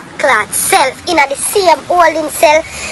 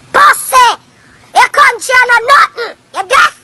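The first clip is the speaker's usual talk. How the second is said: angry jeer; 5 semitones higher than usual. Real, not acted. Ranting at Ray over Jamaica, angry jeer. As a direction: spit the words at him.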